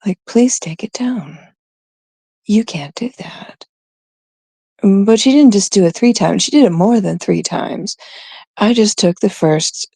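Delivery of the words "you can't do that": hushed whisper. Real, not acted. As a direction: whisper it reproachfully. Whispered aside.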